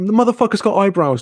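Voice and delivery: high, exasperated outburst; heated insistence. Retold or imitated. Arguing with his mate, exasperated high outburst. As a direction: hit it hard and high, fed up.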